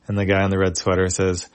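ordinary delivery

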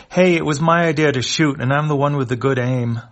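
loud indignant yell. Acted. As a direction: shout it, proud and offended. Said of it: "The symbiont yells".

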